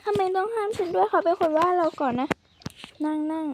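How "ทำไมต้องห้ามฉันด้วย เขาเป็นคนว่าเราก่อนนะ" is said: higher, louder protest; indignant yell, higher and louder; angry outburst. Acted. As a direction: shout back at the friend holding you back. Friend held back mid-quarrel.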